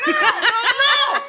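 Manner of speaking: high laughing no; laughing protest. Real, not acted. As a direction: squeal it out through laughter. Mother joins the chat.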